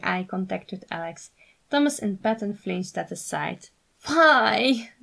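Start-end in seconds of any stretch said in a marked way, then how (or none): 4.1-4.9 pleading. A narrator's reading. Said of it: "Thomas pleaded".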